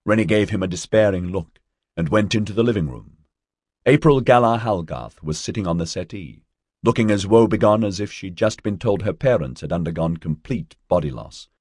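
plain reading voice

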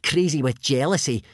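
mild, loud sneer; a touch of mocking contempt. A narrator's reading. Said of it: "sneered Snotlout".

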